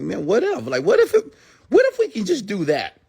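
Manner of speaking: dreaming inventor. Retold or imitated. Steve Jobs dreaming up music on the phone, high and wondering. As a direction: marvel at the idea, voice way up. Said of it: "I'm sure he was like".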